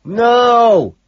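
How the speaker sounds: high, long yell; one full-volume shout. A narrator's reading. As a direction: holler it back. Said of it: "he shouts".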